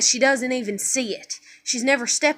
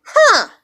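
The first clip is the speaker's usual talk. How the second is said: mock puzzled huh; 8 semitones higher, 7 dB louder than usual. Child self, mock realization.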